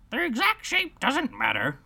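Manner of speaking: dodo voice. Acted.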